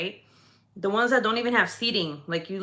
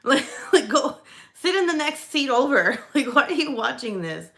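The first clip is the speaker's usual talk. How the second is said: laughing mock scold; 5 semitones higher, 4 dB louder than usual. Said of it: "I'm like".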